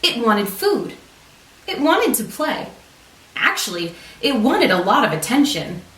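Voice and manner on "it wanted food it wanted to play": ordinary delivery, nothing marked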